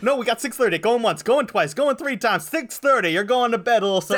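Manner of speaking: loud, high auctioneer closing the sale. Pretend. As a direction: bang the gavel, going once, twice. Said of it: "she used to be an auctioneer".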